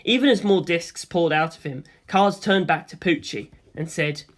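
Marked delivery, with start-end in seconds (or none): none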